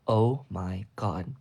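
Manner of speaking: ordinary delivery, nothing marked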